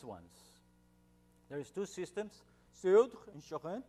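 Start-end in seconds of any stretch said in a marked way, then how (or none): 2.8-3.8 French place names, higher and much louder; best French accent. Shown as a demonstration. Lecturer showing the two French systems to the audience, best French accent. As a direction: give it your best French accent, loud and lifted. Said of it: "that's my best French accent".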